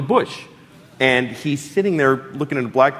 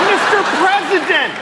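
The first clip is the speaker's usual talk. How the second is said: much higher, louder, laughing yell; 4 dB louder than usual. Retold or imitated. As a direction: call out to the president across the cars. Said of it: "I was literally yelling".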